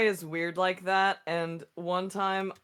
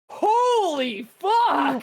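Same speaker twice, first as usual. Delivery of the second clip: high, loud, very slow stunned exclaim; mock shock; 11 semitones higher, 7 dB louder, much slower than usual. Reacting to the chatbot's creepy answer, with a high, loud, very slow mock shock. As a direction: drag it out in stunned disbelief. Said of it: "And I was like".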